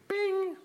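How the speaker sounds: high, bright imitated phone chime; phone ding. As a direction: squeak out the notification ding. Imitating phone notification sound.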